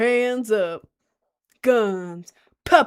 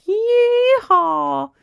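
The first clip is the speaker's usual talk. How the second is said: high, loud whooping yee-haw; cowboy yee-haw; 12 semitones higher, 9 dB louder than usual. Pretend. Cowboy on a horse swinging a lasso, whooping. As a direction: holler it like a rodeo cowboy.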